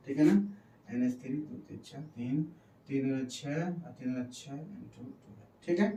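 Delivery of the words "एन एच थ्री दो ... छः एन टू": very soft, low counting to oneself; counting under breath. Real, not acted. Teacher working out the equation on the board, counting under breath. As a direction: count it off quietly, half to yourself.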